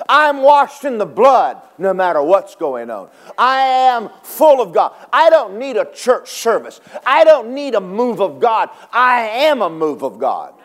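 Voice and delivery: loud, high preaching climax; full-force preaching. Acted. Preacher rallying the church, loud and high. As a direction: preach it hard to the congregation.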